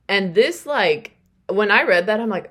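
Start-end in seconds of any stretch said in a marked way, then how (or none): none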